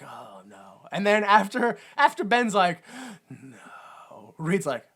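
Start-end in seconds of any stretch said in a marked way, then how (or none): 0.0-0.9 low, very soft murmur; underplay it almost to nothing; mock dismay. Seeing Victor hurt, understated mock dismay. Voicing underwhelmed reaction to burns. 3.3-4.3 low, very soft, drawn-out; mock anguish. Ben after the accident, faint mock-anguished murmur. Voicing Ben's reaction.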